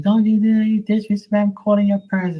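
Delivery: high, loud scolding nun, hammed up; mock angry nun. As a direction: shriek at the kid like an outraged nun principal. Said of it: "She was like".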